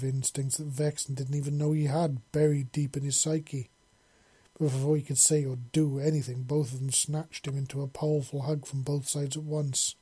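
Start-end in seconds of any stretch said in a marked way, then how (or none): none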